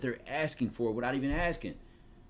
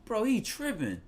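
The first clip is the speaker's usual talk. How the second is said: much higher, Philly street voice; Philly slang; 10 semitones higher than usual. Retold or imitated. Friend backing up his homie in a high Philly voice. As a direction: hype up your boy, Philly style. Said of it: "We're gonna say something like".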